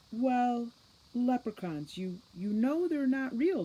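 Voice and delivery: put-on a teen girl who can't believe what she's hearing; high, loud, girlish disbelief; incredulous Juliet. A narrator's reading. Juliet doubts leprechauns exist.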